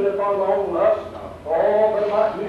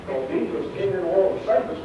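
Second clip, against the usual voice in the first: ordinary delivery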